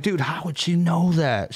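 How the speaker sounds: slow amazed question. Retold or imitated. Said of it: "And I'm like".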